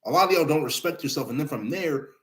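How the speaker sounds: ordinary delivery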